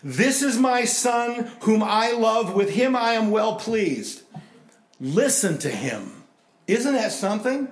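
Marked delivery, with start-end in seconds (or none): 0.0-4.2 higher, louder and slow, like God speaking from above; booming voice from the cloud. Acted. Voice from the cloud proclaiming over the disciples, booming and slow. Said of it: "a voice from the cloud says". 5.0-6.2 slow, louder command; voice from the cloud. Acted. Voice from the cloud commanding the disciples, slow and firm. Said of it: "a voice from the cloud says".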